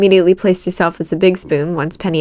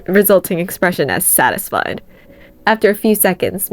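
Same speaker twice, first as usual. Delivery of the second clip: ordinary delivery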